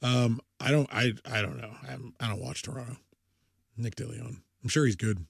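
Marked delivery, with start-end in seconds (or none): none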